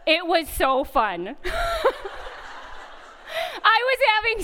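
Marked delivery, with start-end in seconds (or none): 1.4-2.9 real laugh, much higher; high burst of laughter. Real, not acted. Laughing at the memory of her MRI chant. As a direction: break into delighted laughter.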